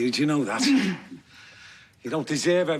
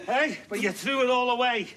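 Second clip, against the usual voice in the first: high, shouted scorn with bitter laughs; mocking angry rant; 12 semitones higher than usual. Tormentor mocking Billy's wasted life, furious and jeering. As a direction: laugh at him while you tear him down.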